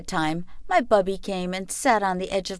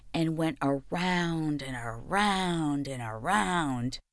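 slow and softer to the end; slowing, fading; 3 semitones lower, 6 dB quieter, slower than usual. A narrator's reading. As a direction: let it wind down dizzily. Dizzy endless ride.

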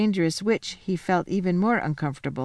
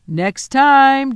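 calling out again; 8 semitones higher, 11 dB louder than usual. A narrator's reading. The Queen calling to Edmund as the sledge speeds away, loudest. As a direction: throw it even louder across the wood. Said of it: "calling out".

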